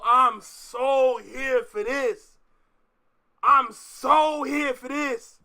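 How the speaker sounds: loud, slow, drawn-out SO; hyped, stretched-out declaration; emphatic excitement. Real, not acted. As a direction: lean hard on "so". Hyped for the rap war.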